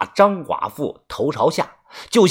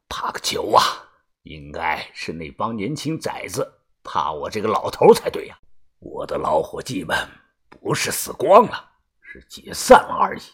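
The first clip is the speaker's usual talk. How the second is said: lower, soft cocky growl; swaggering old man; 10 semitones lower, 5 dB quieter than usual. A narrator's reading. Old grave-robber boasts of his crew, low swaggering voice. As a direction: brush off danger with an old boss's bravado. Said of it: "潇洒地说道".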